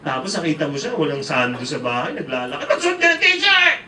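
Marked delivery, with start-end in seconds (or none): 2.7-3.8 high, loud mock shriek; angry parent. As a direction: bellow an order at the child, pitched way up. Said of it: "Galit-galit ka rin". (galit na pasigaw na utos)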